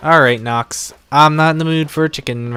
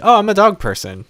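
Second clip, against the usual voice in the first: high-pitched mock realisation; retold conversion; 9 semitones higher, 3 dB louder than usual. Mimicking new dog owners who swore otherwise, high mock enthusiasm. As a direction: voice a convert's gushing change of heart, pitched high. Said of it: "and you're like".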